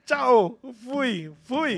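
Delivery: high, loud farewell tossed out; exuberant retold goodbye. Musician walking out on the maestro, gleeful retold shout. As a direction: call it out gleefully, already gone.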